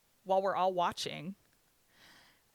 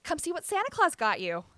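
high, raised wake-up call; cheery mom; 10 semitones higher, 6 dB louder than usual. Retold or imitated. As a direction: imitate a mother calling her kid to presents. Mom waking child on Christmas morning.

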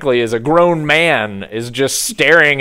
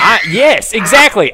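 eager yes; 6 semitones higher, 5 dB louder than usual. Real, not acted. Emphatic laughing agreement.

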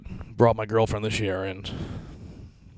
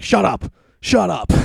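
sharp yell, pitched up high; shouted shut up; 8 semitones higher, 5 dB louder, slower than usual. Retold or imitated. Yelling at the lady on Broadway, a loud shout. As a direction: yell it hard, higher and louder. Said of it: "I was like".

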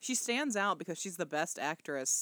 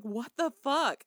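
higher, louder, slow stunned what the fuck; shocked disbelief; 7 semitones higher, 5 dB louder, slower than usual. Retold or imitated. Viewer reacting to the awful reveal, stunned and loud. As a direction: let it drop out in horror. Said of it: "you're just like".

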